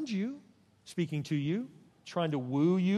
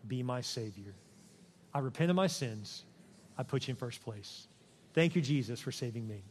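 prayer; 6 semitones lower, 6 dB quieter than usual. Acted. Pastor leads the unsaved in a soft, low prayer. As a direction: pray it gently, phrase by phrase.

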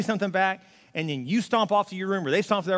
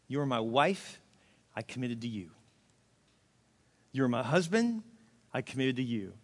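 tender vows; 7 semitones lower, 6 dB quieter than usual. Shown as a demonstration. Pastor voicing marriage vows.

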